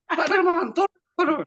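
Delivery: imitating a hot-tempered lady on the phone; short-fused phone demand. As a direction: bark the name like an impatient caller. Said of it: "Suka meledak-ledak deh".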